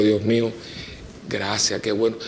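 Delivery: a hushed, moved gracias to God; tearful whispered prayer. Retold or imitated. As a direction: softly, close to tears, thanking God. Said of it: "me echo llorar y digo".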